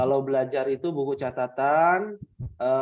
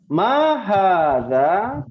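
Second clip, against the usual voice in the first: slow drill question; 3 semitones higher, 6 dB louder, much slower than usual. Shown as a demonstration. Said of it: "teacher drilling class".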